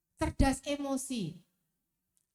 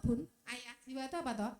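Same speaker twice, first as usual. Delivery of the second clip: ordinary delivery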